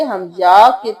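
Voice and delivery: ordinary talk, nothing marked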